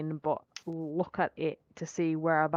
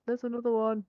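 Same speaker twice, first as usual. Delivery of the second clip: lightly voiced elf call; 5 semitones higher than usual. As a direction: call it out as an elf, barely changed. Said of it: "That was an elf voice".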